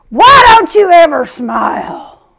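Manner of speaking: loud, slow heckling yell; retold holler. Bread guy hollering at a shopper, mock yell. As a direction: holler it across the store aisle. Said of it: "he starts hollering at me, yelling at me".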